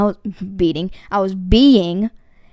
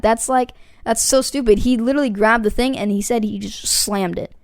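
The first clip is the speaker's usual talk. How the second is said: ordinary talk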